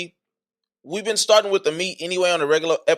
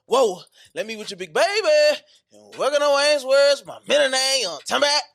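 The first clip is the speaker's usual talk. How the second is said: hype show intro; 8 semitones higher than usual. Acted. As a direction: pump up the crowd like a show host.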